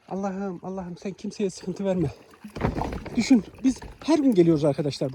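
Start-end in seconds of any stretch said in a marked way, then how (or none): 0.1-2.1 soft, slow plea to God; hushed, heartfelt supplication; quiet prayer. Real, not acted. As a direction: pray under your breath, slowly. Murmured prayer for the animals.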